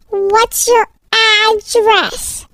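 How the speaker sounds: very slow, word-by-word question; insistent repeat. Acted. Stranger presses a silent child for an address, very slow and insistent. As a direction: draw each word right out, pressing for an answer. Kidnapper asks yet another time.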